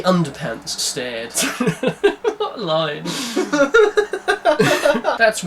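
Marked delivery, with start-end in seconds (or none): none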